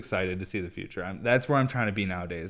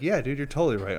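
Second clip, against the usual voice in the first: hired yes-man; 5 semitones higher, 5 dB louder than usual. Pretend. Voicing imagined yes-man sidekick.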